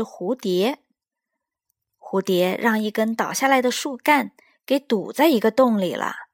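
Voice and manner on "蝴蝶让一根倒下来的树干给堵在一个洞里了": ordinary delivery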